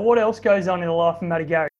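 normal talking voice

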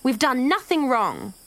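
high, drawn-out protest; understated yell of defiance; mild narrated scream. A narrator's reading. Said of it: "she screamed".

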